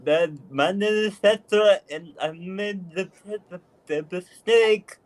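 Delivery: talking with tongue held out; holding tongue with fingers. Pretend. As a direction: speak with your tongue pulled out. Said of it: "now you know how difficult it is to talk like this".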